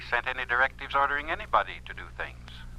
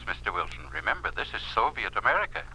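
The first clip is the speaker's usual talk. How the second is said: ordinary talk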